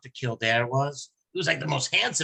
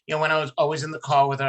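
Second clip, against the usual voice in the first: ordinary delivery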